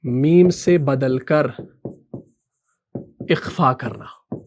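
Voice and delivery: word by word with long pauses; measured chunks for note-taking; slow dictation. Shown as a demonstration. Dictating the definition for notes.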